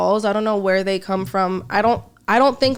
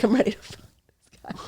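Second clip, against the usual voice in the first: whispered; 4 dB quieter, faster than usual. Retold or imitated. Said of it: "I'd be like".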